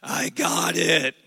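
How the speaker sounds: slow, tired mock reply; weary spouse. Spouse tired of whining, slow mock answer. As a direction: spouse brushing off the whining, drawn out. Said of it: "your spouse is like".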